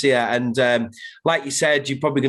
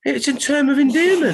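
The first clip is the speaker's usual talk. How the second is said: a higher put-on voice for friends in London or Australia; mock Australian friend; 11 semitones higher, 3 dB louder than usual. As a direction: squeak it out like a friend defending the word. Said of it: "they're like".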